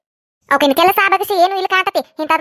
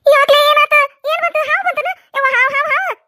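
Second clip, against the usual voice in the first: high, loud frightened cries; snake panic; 9 semitones higher, 6 dB louder than usual. Acted. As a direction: shriek as if a snake is at your feet.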